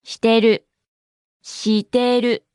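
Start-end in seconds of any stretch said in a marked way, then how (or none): 1.4-2.4 slowly, each syllable drawn out; slow model. Shown as a demonstration. Teacher repeats a new word for learners, slowly and deliberately. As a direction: stretch it out for learners.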